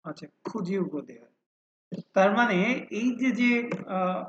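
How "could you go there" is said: could you run together as "kujiu", go there following on; linked pronunciation. Shown as a demonstration. Teacher demonstrates sentence phonology, could you linked as "kujiu". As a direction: blend could into you with a J sound. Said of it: "যখন আমরা বাক্যে ব্যবহার করছি তখন এটা উচ্চারণ".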